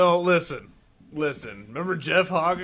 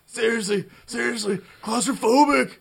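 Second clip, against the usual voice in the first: panicky outburst; 9 semitones higher, 6 dB louder than usual. Real, not acted. Panicking in a scary basement.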